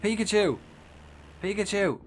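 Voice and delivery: high excited yell of Pikachu; excited shout. Real, not acted. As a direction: shout it out with delight. Spots Pikachu, calls out.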